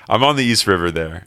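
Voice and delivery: ordinary talk, nothing marked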